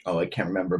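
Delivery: ordinary delivery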